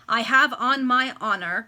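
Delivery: husband protesting loudly to his wife; cried out. A narrator's reading. Loud cry. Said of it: "cried the baronet".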